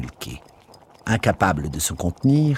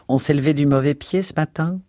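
Mrs Biggs voice; 7 semitones higher than usual. A narrator's reading. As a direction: sniff it out high and prim.

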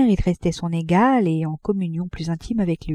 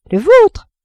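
much higher, louder jab; teasing; 13 semitones higher, 8 dB louder than usual. A narrator's reading. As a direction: toss it at him lightly. Madame Arnould teasing Frédéric. (très aigu et plus fort, moqueur)

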